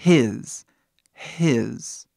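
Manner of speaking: his with a breathy English h. Shown as a demonstration. Teacher repeats his twice to show the h sound. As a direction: say his with the h of carro. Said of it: "Aí a pronúncia seria".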